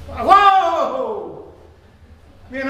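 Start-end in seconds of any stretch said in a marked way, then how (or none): 0.1-1.5 loud, much higher whoa; mock thrill. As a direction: cry out as if on a wild ride.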